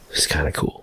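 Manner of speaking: hushed, pleased aside; quiet inner voice. Retold or imitated. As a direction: mutter it like a private thought. Retelling inner thought at bar.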